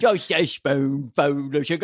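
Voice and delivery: a mock Cockney line from a singer; bad Cockney accent. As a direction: lilt it in a terrible Cockney accent. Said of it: "the worst Cockney accent in the history of film".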